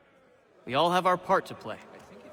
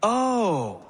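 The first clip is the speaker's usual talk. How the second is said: surprised gasp; 4 semitones higher, 6 dB louder than usual. Acted. Startled at murder confession.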